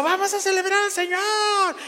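high, bright mocking hype-man call; high hammed-up stage announcer; mock worship leader hype. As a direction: imitate an overexcited worship leader firing up the crowd. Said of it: "y empezó".

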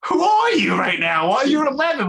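high-pitched mock disbelief; hammed-up, exasperated questioning; incredulous dad. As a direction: act out a baffled parent demanding answers. Acts out dad's baffled reply.

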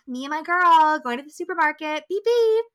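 loud, high pretend driving, rising to a honk; playful joyride. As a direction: play at driving, ending on a high car-horn beep. Acting out riding the spider.

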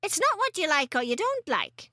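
testy storybook grandma; snappish grandmother. A narrator's reading. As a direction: cut the boy's objection short. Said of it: "Grandma snapped".

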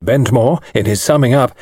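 ordinary delivery

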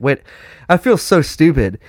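high, loud disbelieving self-quote; excited realization. Retold or imitated. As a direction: exclaim it in sudden disbelief. Retelling own realization, high pitch.

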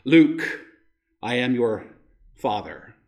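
low, falling Vader line, dropping away at the end; Darth Vader impression. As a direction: give the famous misquote in a low Vader voice. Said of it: "Darth Vader says famously".

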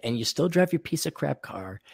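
normal talking voice

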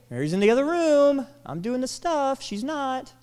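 high, loud exasperated complaint; mock annoyed sister, high and loud; annoyed Martha. Preacher voicing annoyed Martha.

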